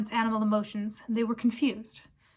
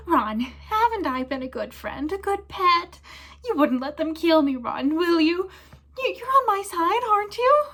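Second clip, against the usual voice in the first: high, loud, squeaky begging; whining plea; 8 semitones higher, 5 dB louder than usual. Acted. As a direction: grovel for the boy's mercy. Pettigrew begs Ron.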